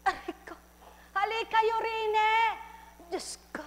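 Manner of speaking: high, strained voice of a limping man; lame man's cry. A narrator's reading. Lame man calling the others, high and strained. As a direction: call out through the pain. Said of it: "Sabi ng pingkaw".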